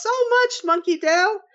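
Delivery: high, bright gushing thanks. Real, not acted. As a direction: gush your thanks up high.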